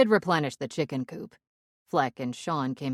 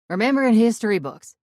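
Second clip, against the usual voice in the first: put-on higher, louder thick Pittsburgh accent; 7 semitones higher, 10 dB louder than usual. Narrator faking a Pittsburgh accent over the radio. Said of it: "in my best Pittsburgh accent".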